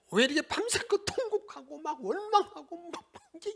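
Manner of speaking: exasperated laugh. Real, not acted. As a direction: laugh through the disbelief.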